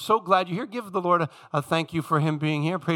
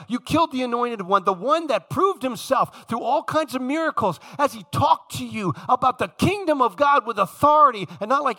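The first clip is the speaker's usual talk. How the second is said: Peter yelling at the crowd; 3 semitones higher than usual. Retold or imitated. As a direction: hurl "You killed him" at the mob. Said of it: "He's now yelling at them".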